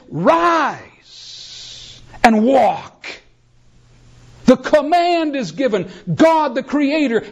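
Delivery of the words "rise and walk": commanding. Acted. Jesus to the lame man, commanding. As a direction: proclaim it as a divine order.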